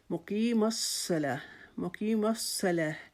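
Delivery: الصلاۃ slow, with a thick doubled ص; heavy sad. Shown as a demonstration. Teacher demonstrating the heavy ص in الصلاۃ. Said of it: "صاد کو موٹا کر دیں گے".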